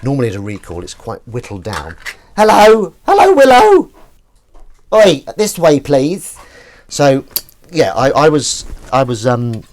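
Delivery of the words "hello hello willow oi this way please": loud, much higher call to the dog. Real, not acted. Owner calling the dog Willow over, loud and high. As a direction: sing out her name to get her attention.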